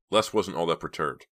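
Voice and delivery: usual speaking voice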